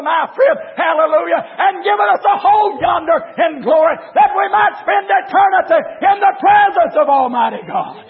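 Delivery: rhythmic revival list, climbing to a peak; full-force preaching. Real, not acted. Preacher lists Calvary's blessings to congregation, full-force preaching. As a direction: pile up each blessing with rising force.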